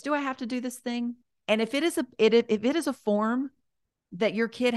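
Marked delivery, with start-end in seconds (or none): 0.0-1.2 composed adult question; grown-up voice. Shown as a demonstration. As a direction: ask it plainly, like a grown-up. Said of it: "Like, in a grown-up voice".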